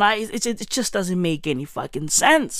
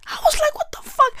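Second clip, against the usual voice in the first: surprised; much faster than usual. Retold or imitated. Shocked reaction while reading messages.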